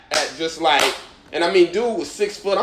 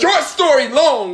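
defiant comeback; 8 semitones higher, 4 dB louder, slower than usual. Real, not acted. Defiant comeback over friends' teasing.

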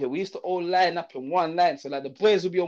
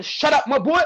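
higher, sharp mock command; headmaster snapping; 8 semitones higher, 4 dB louder than usual. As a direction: cut him off like an angry headmaster. Headmaster silencing him.

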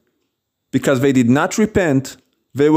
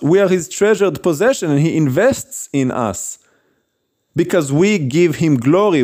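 ordinary talk, nothing marked

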